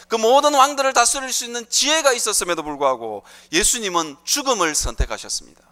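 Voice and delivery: impassioned preaching. Real, not acted. Preacher telling the congregation of Jesus' power, impassioned preaching.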